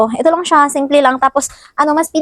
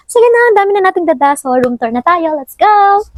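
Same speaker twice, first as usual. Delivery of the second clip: excited; 8 semitones higher, 3 dB louder than usual. Real, not acted. Upbeat start of room tour.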